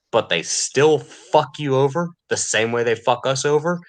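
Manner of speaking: ordinary delivery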